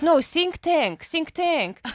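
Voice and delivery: put-on slow, heavy Russian accent, high and loud. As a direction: say think tank in a thick Russian accent. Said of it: "a really thick Russian accent".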